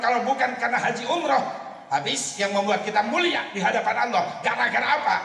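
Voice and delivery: high, loud sermon cadence; fervent preaching. Acted. Preacher urging the congregation, high and loud. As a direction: preach it out, building fervour.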